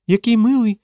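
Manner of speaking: high-pitched woman's exclamation; delighted exclamation. A narrator's reading. Stela exclaims over the parrot, delighted. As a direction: coo over a cute creature. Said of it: "вигукнула Стела".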